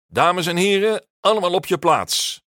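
high, loud announcement; booming teacher's call; calling through cupped hands. A narrator's reading. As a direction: call the class to order like a megaphone. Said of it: "zet zijn handen aan zijn mond als een toeter".

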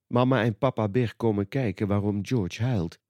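usual speaking voice

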